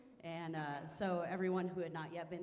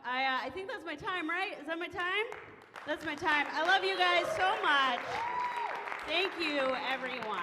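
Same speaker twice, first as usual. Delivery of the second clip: much louder, higher excited goodbye; comic thanking the crowd as her set ends; loud sign-off; 13 semitones higher, 11 dB louder than usual. Real, not acted. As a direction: project the thanks to the back row. Loud wrap-up to audience.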